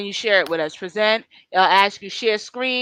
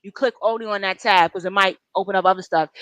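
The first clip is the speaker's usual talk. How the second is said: ordinary delivery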